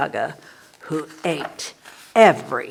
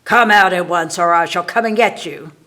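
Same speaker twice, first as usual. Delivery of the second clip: a witch's voice rising to a loud, fast threat; witch calling out; 7 dB louder than usual. Acted. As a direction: call her out of hiding, then bellow the threat. Witch calls out to the girl.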